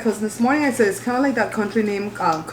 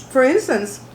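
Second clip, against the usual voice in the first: ordinary talk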